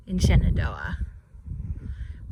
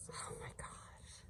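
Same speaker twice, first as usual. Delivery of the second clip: breathless whisper in awe; hushed, slow and amazed; awed whisper; 29 dB quieter, slower than usual. Real, not acted. Awed by the view.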